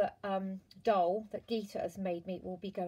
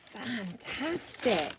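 ordinary talk, nothing marked

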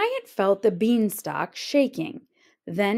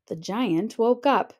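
ordinary delivery

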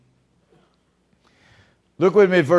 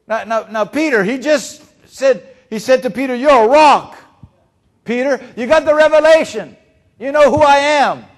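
high, loud, thrilled delivery; crying out Peter's praise with joy; preacher voicing Jesus, thrilled and loud; excited praise; 7 semitones higher, 9 dB louder than usual.

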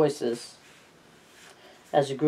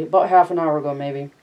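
plain reading voice

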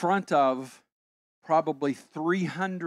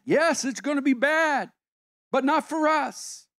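confident reply; 8 semitones higher, 4 dB louder than usual. Acted. Answering the mock dread, confident.

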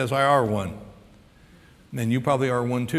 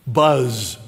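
ordinary delivery

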